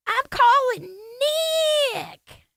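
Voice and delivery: high, drawn-out holler; calling out. Real, not acted. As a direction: holler the name out long and high. Calling out to Nick, live.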